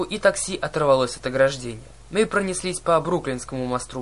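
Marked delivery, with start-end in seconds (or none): none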